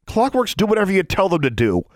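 put-on high indignant girl; Alexandra's voice. A narrator's reading. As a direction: voice the angry girl.

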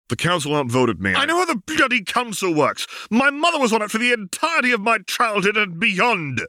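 high, sharp angry retort; snapped. Acted. Caitlin snapping at Jace over the council. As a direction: snap back in fury. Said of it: "Caitlin snapped".

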